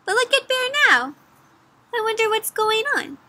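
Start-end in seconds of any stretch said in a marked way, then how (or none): none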